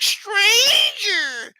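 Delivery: put-on high screaming wail of a scared kid. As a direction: shriek it like a panicked child. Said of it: "And the kid's like".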